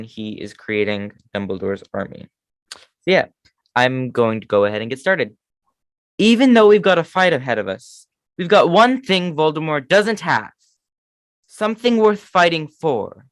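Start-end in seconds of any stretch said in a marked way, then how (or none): 6.2-13.3 put-on earnest, rousing Harry line, no British accent; Harry impression. Harry rallying Dumbledore's army, earnest rousing speech. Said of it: "that was my Harry impression".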